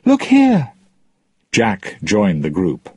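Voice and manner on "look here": very high, loud call. Acted. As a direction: wave him over with your voice. Calling to father in boat.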